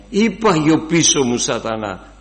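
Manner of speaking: loud commanding cry at Satan; loud rebuke. Acted. Preacher models the rebuke for the church, loud. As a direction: bark the command out.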